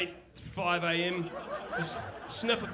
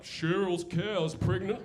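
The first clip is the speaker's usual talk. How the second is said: slow, lightly voiced small-town news presenter; mock TV newsreader; much slower than usual. Comic mimicking a slow New Zealand TV presenter. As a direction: drag it out like a dull local news anchor.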